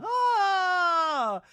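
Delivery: loud, high mock scream; hammed-up frightened squeal; Brittany screaming. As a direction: shriek it in exaggerated panic. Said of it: "has Brittany screaming".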